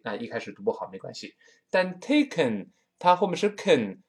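ordinary talk

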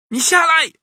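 put-on boy's high-pitched demand; high shout. A narrator's reading. Boy orders the monkey down, shouting. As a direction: yell up at someone in a child's voice. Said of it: "玄玄喊道".

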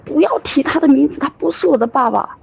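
high-pitched furious retort, lightly mocked; retold angry outburst. Son shouting at his mother not to mention his father, high angry retort. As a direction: fling it back like an enraged teen. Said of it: "他又愤怒了".

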